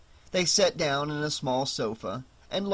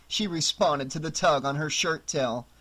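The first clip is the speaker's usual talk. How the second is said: ordinary delivery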